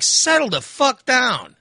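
high, slow, drawn-out retort; exasperated rebuke. Retold or imitated. Driver telling screaming cop off, retold exasperated rebuke. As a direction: stretch each word in retold exasperation.

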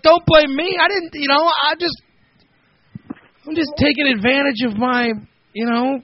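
high, pleading mock protest. Defending herself to angry paparazzi, high whine. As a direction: whine your innocence, hammed-up.

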